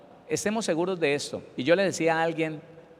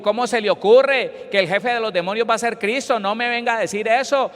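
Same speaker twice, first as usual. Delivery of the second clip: raised, higher angry retort; mock outraged protest; imitated indignation; 5 semitones higher, 4 dB louder than usual. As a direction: snap back offended, as in a retold quarrel. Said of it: "Y se enojó y dijo".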